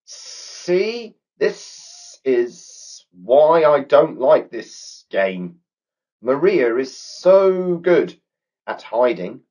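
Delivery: high, slow, complaining snake voice; a grumpy snake sulking over the game; glum snake. A narrator's reading. Snake moaning about the hiding monkey, glum high snake voice. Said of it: "looking rather glum".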